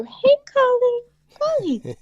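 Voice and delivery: sweet talk. Retold or imitated. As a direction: coo the name sweetly and slowly. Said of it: "You can't sweet talk this dog".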